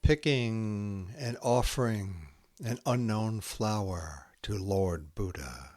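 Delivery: slow, hushed haiku recital with long pauses; poem reading. Acted. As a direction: let each haiku settle into silence. Reads Santoka haiku aloud.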